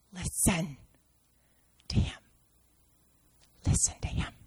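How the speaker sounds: soft, breathy repetition; the Father quietly urging Peter to listen; hushed whisper. Acted. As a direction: whisper it intently.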